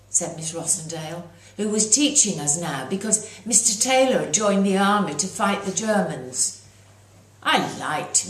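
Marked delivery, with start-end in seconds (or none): none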